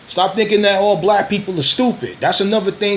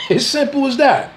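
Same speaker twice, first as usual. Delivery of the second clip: "As simple as that" said again higher, with a laugh; laughing repeat; 7 semitones higher than usual. Real, not acted. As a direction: laugh it off the second time, pitched up.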